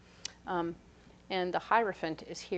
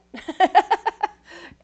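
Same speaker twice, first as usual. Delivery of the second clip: high, loud burst of laughter; 11 semitones higher, 13 dB louder than usual. Real, not acted. Laughing at imagined reactions.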